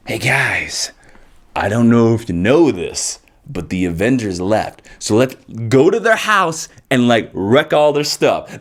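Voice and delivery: a casual low scheming villain pitch; Thanos voice. Retold or imitated. Thanos inviting his crew to wreck Avengers' house, low lazy voice. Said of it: "this Thanos being like".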